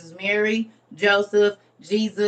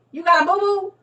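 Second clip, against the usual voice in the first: higher mock baby-talk tease; 9 semitones higher than usual. Teasing a limping friend in high baby talk. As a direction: coo it like to a toddler.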